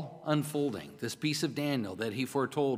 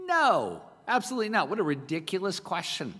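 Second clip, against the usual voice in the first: emphatic no; 4 semitones higher than usual. Shown as a demonstration. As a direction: punch it out like it's absurd. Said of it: "It's got the punch to it".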